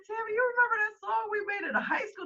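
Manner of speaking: high excited retold question; bubbly reminiscing.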